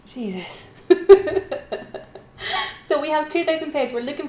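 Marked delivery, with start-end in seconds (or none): 0.9-2.2 loud, high burst of laughter; laughing. Real, not acted. Auctioneer after a terrible Irish impression, laughing. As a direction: crack up at your own performance.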